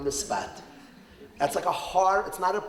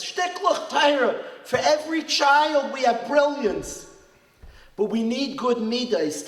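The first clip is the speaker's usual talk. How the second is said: fervent preaching; 8 semitones higher, 4 dB louder than usual. Acted. Rabbi urging parents about their wisdom, rousing sermon. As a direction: lift it like a rousing drasha.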